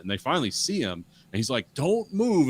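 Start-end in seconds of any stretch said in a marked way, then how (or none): 1.7-2.5 high, loud mock command; mock-authoritative, punched out high. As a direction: imitate a commanding order, pitched up and loud. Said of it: "in this commanding voice".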